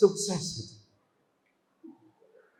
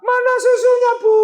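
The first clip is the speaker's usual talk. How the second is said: much higher, louder whining demand; spoiled child yelling for milk; bratty demand; 5 dB louder than usual. Retold or imitated. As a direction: shout at mum for the milk.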